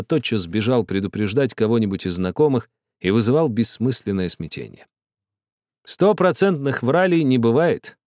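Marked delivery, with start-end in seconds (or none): 5.8-8.0 convinced. A narrator's reading. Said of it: "убеждённо ответил Бабель".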